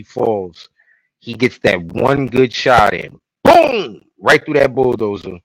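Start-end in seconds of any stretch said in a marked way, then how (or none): 3.4-4.0 high, loud booming burst; imitated gunshot. Storyteller on Joel's one good shot, high loud boom. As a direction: sound the shot with a sudden high cry.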